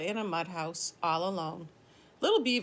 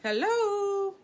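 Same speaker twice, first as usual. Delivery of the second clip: high sung-out hello, much higher; calling hello; 12 semitones higher, 4 dB louder than usual. Shown as a demonstration. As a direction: call hello across a pond. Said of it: "So if I said".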